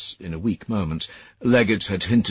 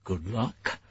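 mouthed; 2 semitones higher, 7 dB quieter than usual. Acted. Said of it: "Sayers mouthed".

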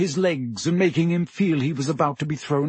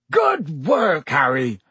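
roared cheer; slower than usual. A narrator's reading. Hagrid praising Harry after the flight, roaring. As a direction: roar out congratulations in character. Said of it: "roared Hagrid".

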